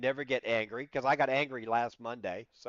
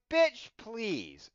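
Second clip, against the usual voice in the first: sassy inner retort; 9 semitones higher, 4 dB louder than usual. Retold or imitated. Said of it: "And all that stayed inside".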